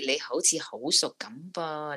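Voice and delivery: normal talking voice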